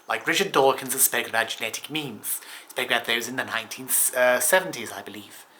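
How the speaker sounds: a light, half-hearted stab at a famous scientist's voice; Richard Dawkins impression. YouTuber briefly mimicking an evolutionary biologist for viewers, lightly voiced. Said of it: "It doesn't sound like his accent at all".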